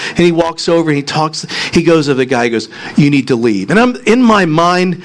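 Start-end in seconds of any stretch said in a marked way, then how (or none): none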